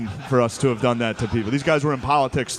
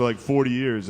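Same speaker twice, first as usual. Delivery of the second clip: ordinary talk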